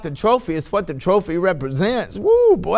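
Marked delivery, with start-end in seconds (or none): 2.2-2.8 high, loud cry of joy; sudden high woo of rapture; ecstatic shout. Real, not acted. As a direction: burst out overwhelmed by the Spirit. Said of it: "I feel the Lord so heavy".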